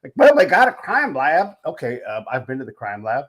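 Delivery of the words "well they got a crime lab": slow, loud, high mock Southern accent. Making fun of staff in a slow, loud Southern accent. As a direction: play a slow Southern know-it-all in a Southern accent. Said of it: "put it in the Southern voice".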